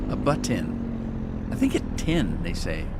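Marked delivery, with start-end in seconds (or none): 0.1-1.5 "button" drawn out slowly, "bu-tin" with a nasal end; A button said very slowly as "a buttin"; slow mock pronunciation. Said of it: "That's how she says it".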